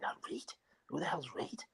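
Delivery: hushed, baffled thought; whispered puzzlement. Retold or imitated. Footballer in the dressing room, whispering who Reid is. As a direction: whisper it to yourself, confused.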